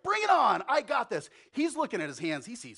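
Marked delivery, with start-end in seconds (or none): 0.0-1.3 much higher and louder cocky shout; full of himself, ready to charge in; brash Peter. Acted. Peter sees strength in his hands, high and cocky. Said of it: "where he's like".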